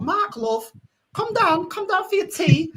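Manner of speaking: put-on high, singsong Scarborough accent. Imitating a family friend calling a child to tea, high Scarborough accent. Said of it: "she used to talk like this, Helen".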